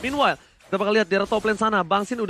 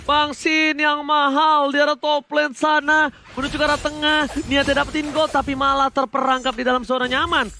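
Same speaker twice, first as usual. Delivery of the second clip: esports caster; 6 semitones higher, 3 dB louder than usual. Acted. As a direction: drag it out for drama. Dramatic slow call. (komentator esports dramatis)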